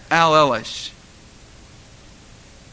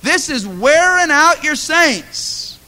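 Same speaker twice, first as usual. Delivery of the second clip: pleading prayer; 11 semitones higher, 7 dB louder, slower than usual. Retold or imitated. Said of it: "I said".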